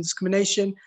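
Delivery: ordinary delivery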